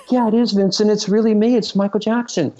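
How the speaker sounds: very high-pitched imitated phone caller. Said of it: "I hear this voice, and he goes".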